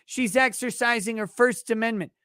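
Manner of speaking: usual speaking voice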